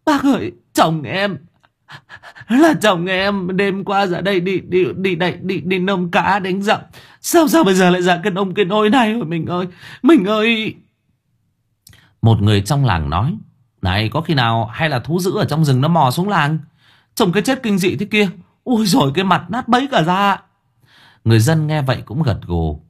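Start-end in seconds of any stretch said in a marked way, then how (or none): 0.1-10.8 high, breaking voice of grief; tearful sobbing. Acted. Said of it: "Cô Trang mếu máo". 13.8-20.4 a hint of a high villager voice; lightly voiced anxious villager.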